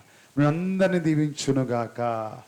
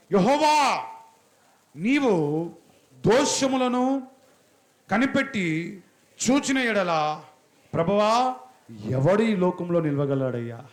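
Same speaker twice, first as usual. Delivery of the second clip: impassioned scripture reading; 3 semitones higher, 3 dB louder, slower than usual. Acted. Reads psalm as impassioned prayer.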